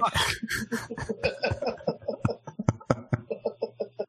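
can't hold the laugh in; stifled giggles. Real, not acted. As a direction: burst of high giggling. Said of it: "trying to keep a straight face".

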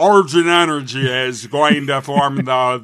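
slow, loud, hammy lore reading; bad reading voice. Shown as a demonstration. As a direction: read lore in a silly voice. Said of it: "worst voice I can think of".

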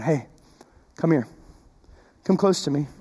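soft, low, gentle invitation; tender. Retold or imitated. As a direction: coax them close like a brother. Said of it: "tenderly Joseph going".

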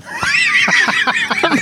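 high burst of laughter; laughing. Real, not acted. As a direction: laugh at the mistake. Laughs at the mix-up.

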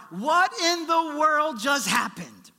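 loud, slow disbelieving exclaim; incredulous. Real, not acted. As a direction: throw up your hands at this.

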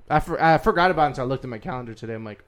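ordinary talk

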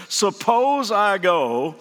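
slow, drawn-out hesitant hypothetical; hesitant Moses. Retold or imitated. Preacher voicing Moses hedging before God, slow and hesitant. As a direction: stall nervously, stretching each word. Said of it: "He's like saying".

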